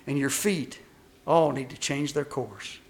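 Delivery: ordinary talk, nothing marked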